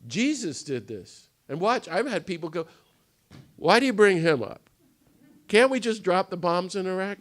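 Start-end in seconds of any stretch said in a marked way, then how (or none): 3.5-7.2 raised, louder voice of a parishioner pushing back; mock objecting parishioner. Parishioner challenging the priest, mock indignant. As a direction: throw their objection back at the crowd, a bit heated.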